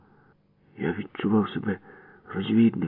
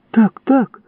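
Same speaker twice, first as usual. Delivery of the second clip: very high, loud eager yes; joyful cry; 8 dB louder than usual. Acted. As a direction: burst out gladly. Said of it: "вигукнув я радісно". (радісний вигук)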